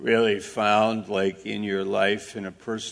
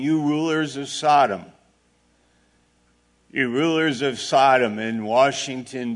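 lightly voiced divine rebuke, raised and louder; a touch of a loud, indignant address to rulers; God; 4 semitones higher, 5 dB louder than usual. God addressing the rulers of America, lightly acted loud rebuke. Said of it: "like God speaking to the United States".

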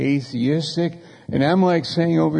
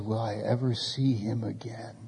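soft whispered question, retold; tearful whisper; 3 semitones lower, 10 dB quieter than usual. Mother asks preacher about her dead son, whispered. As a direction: whisper it like a grieving mother asking. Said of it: "she starts weeping".

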